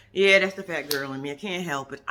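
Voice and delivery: usual speaking voice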